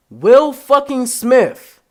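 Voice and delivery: high, loud, very slow; loud disbelieving exclamation; indignant outburst. Real, not acted. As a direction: drag out his disbelief.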